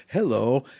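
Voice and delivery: mild imitation of a Belgian accent. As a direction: lightly put on a Belgian accent answering the phone. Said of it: "he answers the phone in his Belgian accent".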